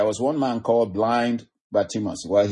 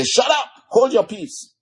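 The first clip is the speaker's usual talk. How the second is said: high-pitched sharp order to be silent; shushing crowd; 13 semitones higher than usual. Acted. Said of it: "many of them told him to shut up".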